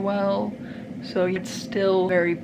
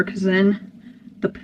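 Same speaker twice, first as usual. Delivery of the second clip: usual speaking voice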